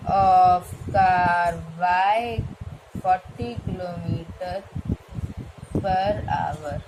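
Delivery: drawn-out, word-by-word dictation pace; slow dictation. Shown as a demonstration. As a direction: dictate slowly as you write it on the board. Teacher dictates final answer. (धीमे-धीमे लिखवाते हुए बोलना)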